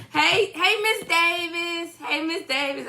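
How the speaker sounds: high singsong kiddie greeting; baby shark voice. Acted. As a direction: greet her like a children's song. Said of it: "let me say my baby shark voice".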